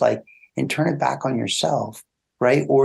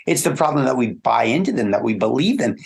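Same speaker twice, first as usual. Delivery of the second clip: usual speaking voice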